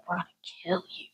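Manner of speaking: a hushed, sinister whisper; menacing whisper. Retold or imitated. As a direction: breathe the threat under your breath. Kids' silent malice imitated.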